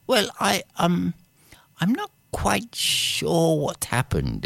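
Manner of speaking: high, hesitant and embarrassed; sheepish gardener. Acted. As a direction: stammer it out, unsure.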